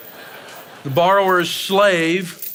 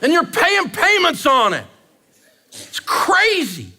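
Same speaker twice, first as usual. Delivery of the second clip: fired up; 10 semitones higher than usual. Real, not acted. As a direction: shout it with a grin. Ranting about truck payments.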